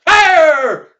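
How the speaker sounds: high, long-held bellow; shouted firing command. Acted. Wayburn to the gunmen, bellowed order.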